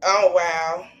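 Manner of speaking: ordinary delivery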